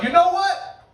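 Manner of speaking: loud, high, mock-proud declaration; defiant comeback. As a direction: throw it back like a rap battle.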